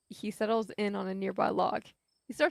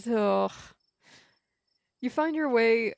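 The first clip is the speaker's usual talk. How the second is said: ordinary delivery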